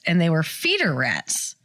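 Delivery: ordinary delivery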